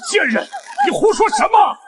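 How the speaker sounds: loud, enraged outburst; angry shout. Acted. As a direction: lash out at her. Master furious at concubine.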